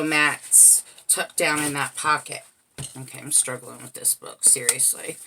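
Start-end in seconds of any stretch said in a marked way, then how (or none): 3.0-5.2 muttered aside. Real, not acted. Muttered aside while struggling.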